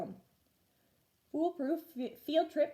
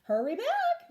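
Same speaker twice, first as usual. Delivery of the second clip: a hint of a distracted teacher, pitched up; lightly voiced busy teacher; 14 semitones higher, 5 dB louder than usual. A narrator's reading. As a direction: toss it off while busy. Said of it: "said distractedly".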